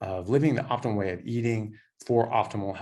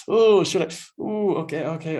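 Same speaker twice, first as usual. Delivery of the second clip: high, hesitant mock Ramsay, much higher than usual; Gordon Ramsay hesitating; 8 semitones higher, 7 dB louder than usual. Ramsay working himself up to taste breast milk, high and hesitant. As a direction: squeak out nervous reluctance. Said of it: "Gordon Ramsay was just like".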